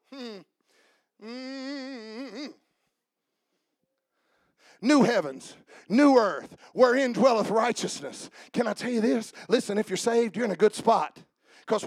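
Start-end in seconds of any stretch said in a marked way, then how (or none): none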